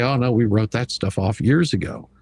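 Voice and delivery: ordinary talk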